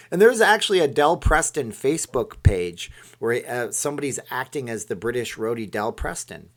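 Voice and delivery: ordinary delivery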